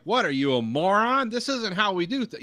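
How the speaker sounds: loud, high mock-exasperated American voice; Schultz. Mimicking the narrator's Secretary of State, hammed-up loud voice. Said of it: "He always talked like this".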